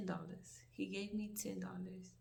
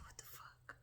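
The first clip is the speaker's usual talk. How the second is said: whispered; 14 dB quieter than usual. Retold or imitated. Shocked at wrong change.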